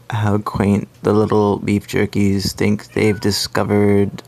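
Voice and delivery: loud, slow, patronizing; condescending. Acted. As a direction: talk down to tiny humans. Plant domain speaks, condescending.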